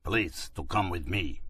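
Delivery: put-on a light Turkish accent; broken English in a Turkish accent. A narrator's reading. Driver speaks, accented.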